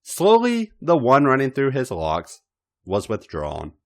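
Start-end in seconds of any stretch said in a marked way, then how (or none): none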